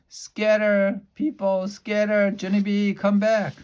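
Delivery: high, loud frantic "Scatter, people, scatter"; calling out. A narrator's reading. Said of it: "called Mother".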